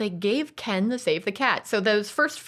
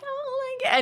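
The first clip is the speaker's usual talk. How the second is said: put-on squeaky old lady gasp, pitched way up. The old lady watching Mister Incredible, high alarmed gasp. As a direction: clutch your pearls as the old lady. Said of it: "the old lady is like".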